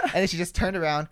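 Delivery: ordinary delivery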